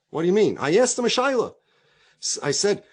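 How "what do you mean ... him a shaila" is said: raised, higher-pitched mock protest; acted indignant self-defence. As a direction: protest as if wrongly accused. Acted car thief protesting.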